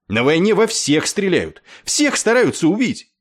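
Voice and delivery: loud, high-pitched rebuttal; heated, loud retort; exasperated shouting. A narrator's reading. Clevinger's shouted side of the quarrel.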